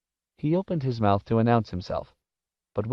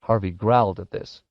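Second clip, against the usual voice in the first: plain reading voice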